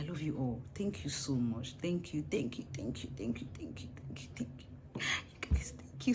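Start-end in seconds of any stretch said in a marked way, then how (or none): 3.6-5.0 softer whispered thanks trailing away. Real, not acted. Vlogger thanking her viewers, fading into a whisper. 5.4-6.1 slow whispered thank-you. Real, not acted. Vlogger thanks her guests and viewers, whispered.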